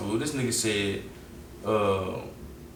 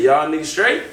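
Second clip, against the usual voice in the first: calling out; 4 semitones higher, 6 dB louder than usual. Retold or imitated. Calling down the street.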